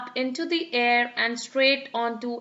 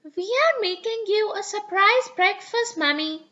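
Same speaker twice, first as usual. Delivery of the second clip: put-on little girl voice; 8 semitones higher than usual. Peppa's voice.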